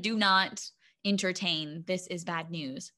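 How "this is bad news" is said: ordinary talk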